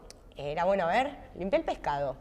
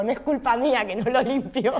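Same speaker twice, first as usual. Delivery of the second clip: mock excuse, laughed out higher and louder; laughing; 5 semitones higher, 5 dB louder than usual. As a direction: laugh while making the excuse. Joking about fainting to escape.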